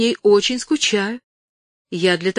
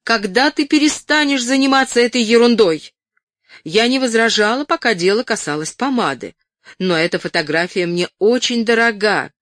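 raised, higher, indignant scolding; barely contained anger; 4 semitones higher, 6 dB louder than usual. A narrator's reading. Ellen scolds Stephanie over the taken photo, angry but restrained. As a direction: scold her, just short of shouting. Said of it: "с трудом удерживалась, чтобы не закричать".

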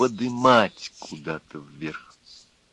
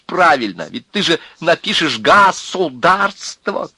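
high, loud outburst of abuse; furious tirade, voice pitched up; indecent angry shouting; 7 semitones higher, 4 dB louder than usual. A narrator's reading. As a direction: yell insults in boiling protest. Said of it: "Он закричал неприлично".

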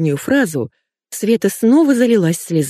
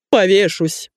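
theatrical cry at ordinary volume; an understated bellow of despair; man's mock yell; 2 semitones higher, slower than usual. A narrator's reading. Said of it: "заорал он". (сдержанный театральный вопль)

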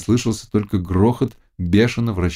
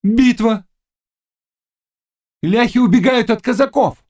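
very high, raised seer's cry; witch crying visions; 4 dB louder than usual. A narrator's reading. Witch seeing Poles flee Cossacks, crying out. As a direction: call out the battle high and urgent.

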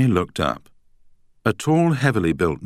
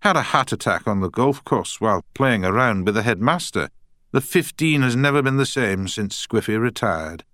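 put-on Scottish accent; 6 semitones higher than usual. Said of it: "in a strong Scottish accent".

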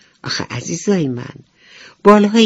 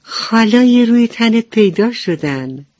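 higher, louder joyful news; happy announcement; 7 semitones higher, 6 dB louder than usual. Acted. Cricket telling the ladybug her spots are back, joyful. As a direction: share the good news with delight. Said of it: "با خوشحالی گفت".

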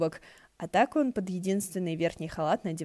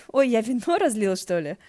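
louder, higher startled cry; surprised; 4 semitones higher, 7 dB louder than usual. Acted. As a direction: caught off guard by spilled wine. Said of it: "удивился Ши Цинсюань". (удивлённо, громко и выше)

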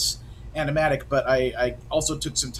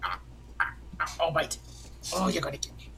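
playful cat voice; 10 dB quieter than usual. Retold or imitated. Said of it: "he's in a playful mood of".

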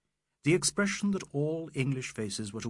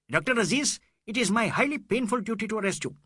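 high, louder shrill official voice; shrill tones; 10 semitones higher, 5 dB louder, faster than usual. A narrator's reading. Inspector of police arresting Doctor Aziz, shrill tones. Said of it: "said in shrill tones".

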